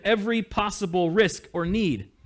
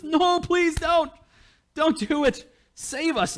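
much higher, slow begging voice; pleading Moses; 11 semitones higher, slower than usual. Retold or imitated. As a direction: beg like Moses on his knees.

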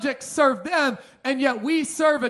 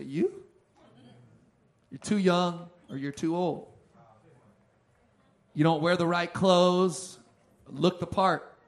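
judging doubt; 6 semitones lower, 3 dB quieter than usual. Retold or imitated. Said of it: "they judge me".

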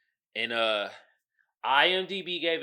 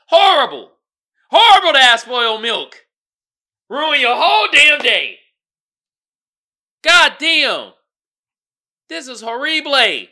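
loud, high disgusted yelling; disgusted outburst; 9 semitones higher, 15 dB louder than usual. Real, not acted. Ranting to viewers about spoiled milk, loud disgusted yelling. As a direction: blow up in disgust.

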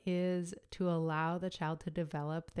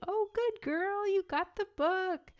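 much higher, slow gushing praise; praising baby voice; 14 semitones higher, 4 dB louder than usual. Shown as a demonstration. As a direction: gush over the baby like a proud parent. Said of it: "rather than saying".

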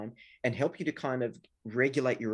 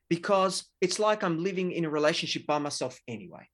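loud, high, bitter accusing rant; a spouse hurling a hard, loud disclosure; harsh accusation; 5 semitones higher, 5 dB louder than usual. Retold or imitated. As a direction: lay it on hard at your partner. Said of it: "Absolutely laid it on hard".

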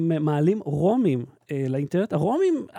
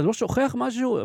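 higher, lightly acted dub line; dubbed cartoon dad; 7 semitones higher than usual. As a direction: lightly mimic a dubbed movie parent. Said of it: "אבא יוצא ואומר". (חיקוי קל של אבא מדובב)

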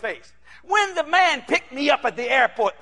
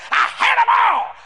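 high, slow angry yell; mimicked hateful outburst; 9 semitones higher, 3 dB louder, slower than usual. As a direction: stretch it out as a raging pastor would. Acting out pastor cursing his members.

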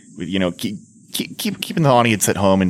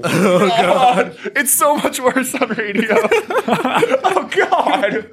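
talking through real laughter; high-pitched laughing disbelief; 14 semitones higher, much slower than usual. Real, not acted. Reacting to gargled beer lines.

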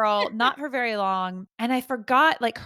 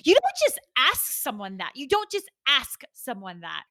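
imitated outrage, pitched up at the start; indignant retort; 4 semitones higher than usual. Girlfriend scolding brother for asking about the mob, mock indignant. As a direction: act out an affronted telling-off, hitting the questions.

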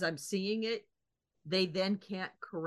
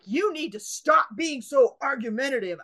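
louder mock stern clerk rebuke; 5 semitones higher, 8 dB louder than usual. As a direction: scold her like a fed-up official. Retold clerk scolding.

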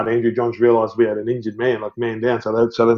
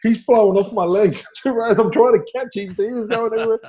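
much higher, laughing through the words; giggly and thrilled at the chaos; dad laughing about his son flying two rows; laughing, excited; 11 semitones higher than usual. Real, not acted.

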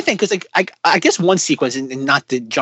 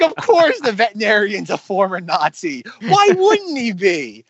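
sarcastic; 4 semitones higher than usual. Real, not acted. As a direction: roll your eyes through it, stretched out. Mocking the Nazi vet twist.

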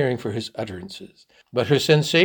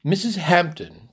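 slow, higher, weighty address; increased solemnity; 6 semitones higher, 4 dB louder than usual. A narrator's reading. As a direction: begin gravely, drawing out the name. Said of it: "began Leonard with increased solemnity".

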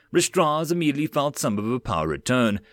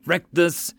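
cursing; 6 semitones higher than usual. Acted. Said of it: "Mills cursed".

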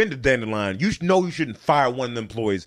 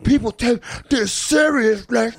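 high, slow, strained mock outrage; hammed-up high voice of someone flipping out; imitated freak-out; 7 semitones higher, slower than usual. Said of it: "this guy was flipping out".

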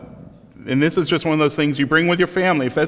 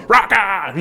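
mock teen insult; 8 semitones higher, 5 dB louder than usual. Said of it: "It's like".

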